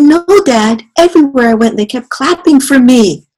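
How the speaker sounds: put-on high, loud delighted kid voice; proud baby mosquito. Baby mosquito telling his dad, high delighted voice. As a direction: beam like a proud child.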